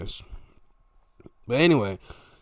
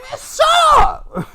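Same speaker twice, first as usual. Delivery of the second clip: drawn-out falsetto shout; hammed-up exasperation; mock outburst; 12 dB louder, much slower than usual. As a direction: yell it very high and loud to cut off the squabble. Acted angry retort at the wrestlers.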